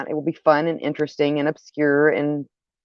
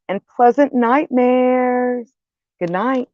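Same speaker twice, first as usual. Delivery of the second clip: slow, spooky sign-off; horror host; 7 semitones higher, slower than usual. Acted.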